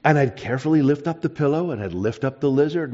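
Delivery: ordinary delivery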